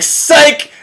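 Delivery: high-pitched mock gotcha; gleeful taunt. Ruler reneging after hail stops, gleeful taunt. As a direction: jeer it high as the trick lands. Pharaoh breaks his promise again.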